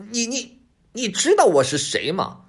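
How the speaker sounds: high, halting fox voice; stammer, then force out a bold question; nervous fox feigning composure; fox trying to steady himself. A narrator's reading. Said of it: "尽量稳住声音， 不紧不慢地问".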